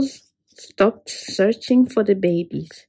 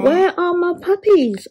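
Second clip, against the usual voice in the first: high, anxious little question; worried dog; 9 semitones higher than usual. Acted. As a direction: call out for the lost puppies. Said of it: "asked Dog".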